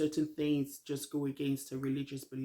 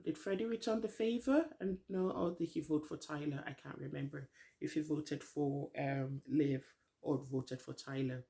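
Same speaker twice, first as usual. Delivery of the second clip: normal talking voice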